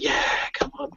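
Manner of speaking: high, soft imitation of a cheer; cheering crowd. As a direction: mimic a distant crowd cheering. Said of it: "crowd start making a noise".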